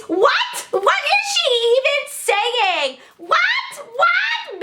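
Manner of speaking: very high, drawn-out disbelief; outraged mimicry. Student mimicking her teacher's reaction, high mock outrage. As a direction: shriek each question slowly, mocking.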